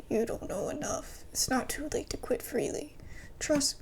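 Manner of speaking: hushed, urgent whisper; whispered warning. Acted.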